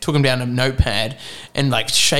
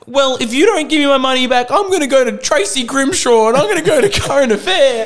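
much higher, mock-shaky teenage threat; hammed-up panicky kid making threats, near laughing; shaky eighteen-year-old; 11 semitones higher, 4 dB louder than usual. Teen threatens agency with TV exposure, shaky. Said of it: "a shaky eighteen-year-old me said".

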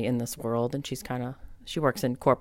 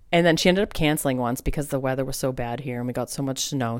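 normal talking voice

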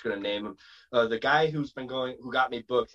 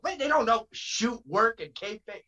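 high-pitched worked-up wrestling talk; Cornette; 9 semitones higher than usual. Real, not acted. Wrestler rattling off carny words, high-pitched and worked up. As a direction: push it up high, sounding like a wrestling personality. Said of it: "Now I sound like Cornette".

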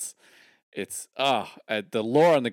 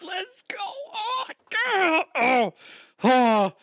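very high, squeaky excited cries; overwhelmed squeal; much slower than usual. Real, not acted. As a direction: burst with excitement. Overwhelmed gamer reacting to story.

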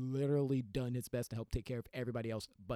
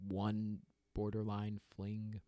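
ordinary delivery

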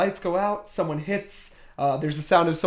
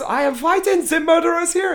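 high-pitched, hammed-up French accent; Yvette, awful French accent; 12 semitones higher, 4 dB louder than usual. Retelling the maid's alarm to the guests, awful French accent. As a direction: panic in a bad French accent. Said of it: "in this awful French accent".

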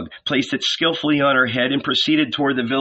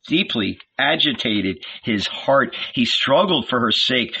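ordinary delivery

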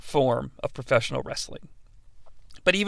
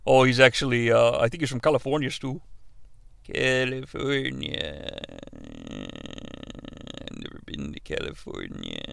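very low, soft mumbled voice; hushed low-pitched impersonation, trailing away; Stu Hart; 9 semitones lower, 11 dB quieter than usual. Acted. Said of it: "sidetracked with the Stu Hart impression".

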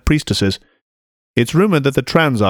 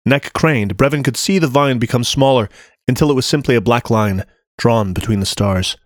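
ordinary delivery

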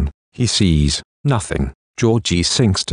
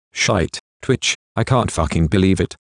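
ordinary delivery, nothing marked

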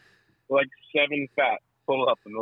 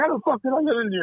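panicked confusion; 10 semitones higher, much faster than usual. Retold or imitated. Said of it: "You're like".

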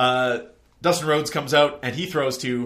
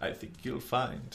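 Palpatine impression; 10 dB quieter than usual. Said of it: "We just do a Palpatine impression".

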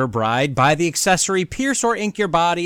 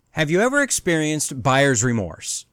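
ordinary delivery

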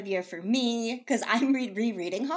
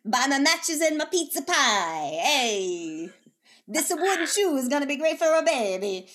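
loud, slow, hammed-up Danish accent; badly faked Danish accent, loud; 5 semitones higher, 4 dB louder than usual. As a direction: sell matches in a hopeless Danish accent. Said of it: "miss an accent by such a wide margin".

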